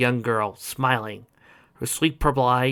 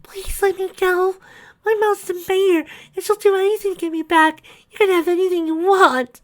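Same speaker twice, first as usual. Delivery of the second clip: put-on high, whispery begging girl; pleading girl voice; 6 dB louder than usual.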